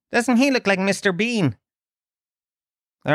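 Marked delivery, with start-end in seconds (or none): 0.1-1.6 put-on high-pitched child asking a question; boy's friend. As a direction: imitate a cheeky kid, pitched up. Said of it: "then his friend comes over".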